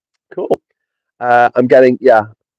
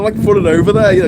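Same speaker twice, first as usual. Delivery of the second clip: ordinary talk, nothing marked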